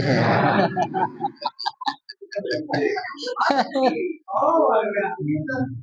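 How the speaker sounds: high burst of shouted laughter. Real, not acted. Audience joke about RT meeting.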